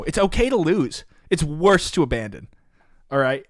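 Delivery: frustrated scolding. Real, not acted. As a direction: scold him like a coach who's fed up. Scolding opponent who bailed.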